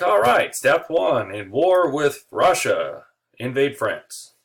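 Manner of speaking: German generals unveiling the Schlieffen Plan; hammed-up war plan. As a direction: announce the plan like a gung-ho general.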